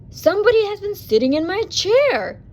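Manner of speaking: a big bear grumbling loudly about his porridge; loud growling bear; loud growly voice. A narrator's reading. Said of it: "he said in his loud, growly voice".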